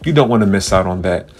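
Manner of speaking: big booming show host voice; announcer. Pretend. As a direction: play the grand host welcoming fans. Grand mock podcast host intro.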